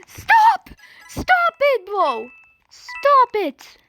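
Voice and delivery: high panicked screams of stop; screaming in fear. Real, not acted. Someone ruining the moment.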